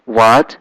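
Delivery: "What" rising at the end; question tone. Shown as a demonstration. Teacher likening second tone to a question, rising "What". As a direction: ask "What" with an upward lift. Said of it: "like question".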